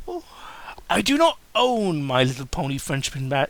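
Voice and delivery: usual speaking voice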